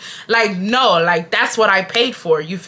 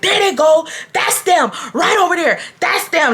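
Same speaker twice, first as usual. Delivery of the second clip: high-pitched imitation of an accuser; mock tattling; 8 semitones higher than usual. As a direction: call out urgently, pointing out culprits. Old man pointing them out to staff.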